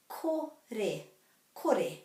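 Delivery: kore, both vowels clear: ko-re; kore with the final e sounded. Shown as a demonstration. Teacher models kore for learners, final e said. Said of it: "the E at the end of the word is always pronounced".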